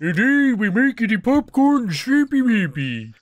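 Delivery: high, loud mock-Swedish chef announcing a dish. Chef impression for a friend: high loud sing-song Swedish accent. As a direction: play the Muppet chef presenting the recipe.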